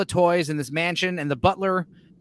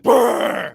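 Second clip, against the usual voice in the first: louder rolling growl; loud werewolf growl; 3 semitones higher, 7 dB louder than usual. Retold or imitated. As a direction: growl at the man like a beast. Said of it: "just be like".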